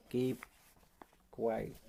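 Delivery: plain reading voice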